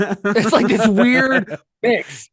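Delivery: high, slow, strange drawn-out voice; weird noise. Retold or imitated. Podcast host mimicking a bizarre action-movie face noise, slow and high. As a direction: mimic a bizarre screaming ghost. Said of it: "makes a weird noise".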